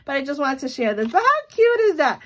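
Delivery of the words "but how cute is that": much higher, gushing squeal of delight. Real, not acted. Admiring the gift she made, squealing with delight. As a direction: swoon over how cute it is. Admiring finished happy mail.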